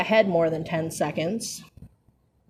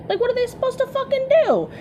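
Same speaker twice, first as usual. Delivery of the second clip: much higher, incredulous repeat; exasperated high squeal; faster than usual. Shown as a demonstration. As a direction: shoot the pitch way up in disbelief. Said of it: "repeated line".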